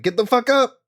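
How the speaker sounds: loud, high-pitched urgent yell; wake-up shout. Acted. Joran finds T B asleep, urgent wake-up shout. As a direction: yell to rouse a sleeper. Character smacks and wakes sleeper.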